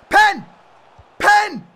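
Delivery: loud high protesting shouts; appealing to ref. Real, not acted. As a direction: plead with the ref at full volume. Demanding penalty from ref.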